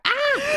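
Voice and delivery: high, loud squeal of laughter; laughing shriek. Real, not acted. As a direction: shriek with delight. Shrieking at the meme.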